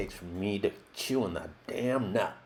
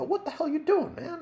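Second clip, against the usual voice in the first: much higher outraged protest; indignant yelp. Retold or imitated. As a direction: yelp at the director who hit him. Whacked on tail, protests.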